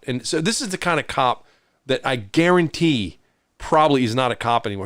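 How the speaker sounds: usual speaking voice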